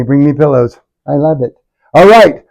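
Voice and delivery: ordinary talk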